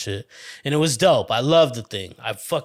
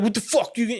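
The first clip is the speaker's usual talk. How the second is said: tough-guy threat; 9 semitones higher, much faster than usual. Retold or imitated. Older kid threatening him.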